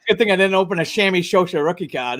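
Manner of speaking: "Sammy Sosa" with every s turned to sh; lisping. Retold or imitated. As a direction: lisp each s as a slushy sh. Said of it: "Tim said, like Tim said".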